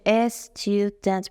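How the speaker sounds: استودنت slowly as es, tu, dent; three beats in استودنت, starting with es; wrong syllable split. Shown as a demonstration. Teacher showing what it cannot be, wrongly split. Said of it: "و نمیشه".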